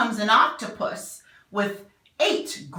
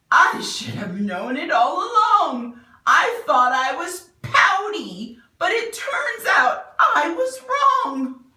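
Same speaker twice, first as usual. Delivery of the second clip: louder and higher, a hint of joy; lightly voiced happy fish; 7 semitones higher, 7 dB louder than usual. The fish tells his friends he was wrong, happier. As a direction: announce the discovery gladly.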